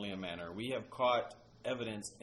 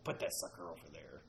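low gravelly ghost voice; 7 semitones lower, 7 dB quieter than usual. Retold or imitated. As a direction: rasp it low like a ghost recording. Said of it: "this gravelly voice saying".

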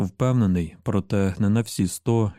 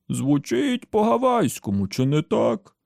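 high, put-on sepulchral voice; boy's high-pitched spooky quotation; sepulchral quoting voice; 6 semitones higher than usual. A narrator's reading. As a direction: quote it as a mock voice from the grave. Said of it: "процитував Джейк за могильним голосом".

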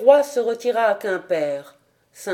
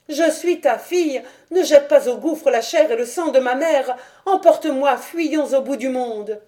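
higher, urgent, out-of-breath begging; breathless pleading; 5 semitones higher, 3 dB louder than usual. Acted. Dahu clings to the old king and begs him, breathless. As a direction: plead for your life, panting. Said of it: "suppliait, haletante".

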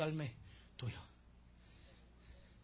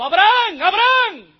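loud, high cry of the name, drawn out; angel calling out; 19 dB louder, slower than usual. Acted. El ángel de Jehová llama a Abraham desde el cielo, gritando fuerte. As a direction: call down from heaven with full force. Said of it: "gritando y diciendo".

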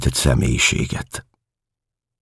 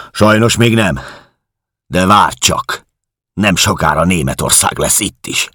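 higher, louder menacing boast; loud threat; 6 semitones higher, 4 dB louder than usual. Acted. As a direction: gloat as you threaten.

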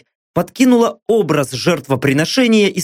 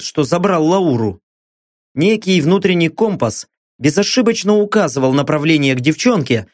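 ordinary delivery, nothing marked

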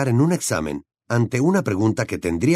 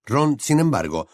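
plain reading voice

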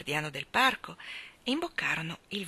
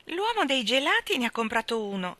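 meravigliato; 7 semitones higher, 3 dB louder than usual. A narrator's reading. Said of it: "con gli occhi tondi per la meraviglia".

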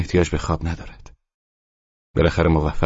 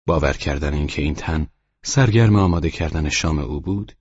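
ordinary delivery, nothing marked

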